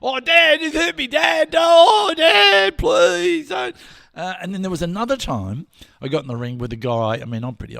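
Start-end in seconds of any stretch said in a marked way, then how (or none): 0.0-3.8 high, loud mock whimpering child; whiny hurt kid. Beaten son begging his dad, high loud and whiny. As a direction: whine like a beaten little boy.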